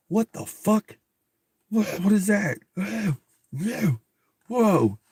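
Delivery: high, stunned what the fuck and whoas; shocked. Real, not acted. Reader staring at the picture, shocked and amazed. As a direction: gasp it out in disbelief.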